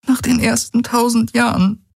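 hohe Frauenstimme, traurig und resigniert. Acted. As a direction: sag es hoch und schwer, voller Abschied. Ki voiced. (Ki zu Galen: hohe, traurige Stimme)

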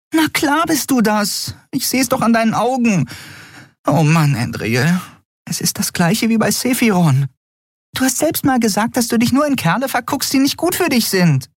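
very high, insistent squeak; high-pitched, exasperated lecturing; know-it-all Nelen. A narrator's reading. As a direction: needle a friend in a tiny high voice.

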